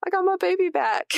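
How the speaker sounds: put-on high, gushing baby-talk voice; delighted high-pitched mock coo; gushing mom. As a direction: coo like a mom who got her little girl back. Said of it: "And I was like".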